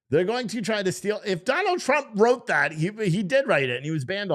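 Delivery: high, loud, exclaiming protest; heated indignation. Real, not acted. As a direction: argue it worked up and outraged. Indignant argument about double standard.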